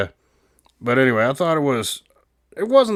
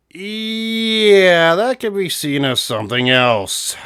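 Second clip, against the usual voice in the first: long drawn-out yeah, pitched high and swelling louder; mock-scandalized, stretching the first word way up; exaggerated reaction; 9 semitones higher, 4 dB louder, much slower than usual. Host reacting to the chicken-loving line, exaggerated drawn-out reaction. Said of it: "I'm like".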